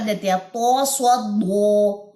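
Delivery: سواد دو said slowly, with the دال made heavy and full-mouthed like the صاد; slow demo of سواد دو with a wrongly heavy دال; wrong pronunciation. Shown as a demonstration. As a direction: demonstrate the students' mistake by thickening the دال in سواد دو. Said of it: "یہ pronunciation غلط ہو جاتی ہے".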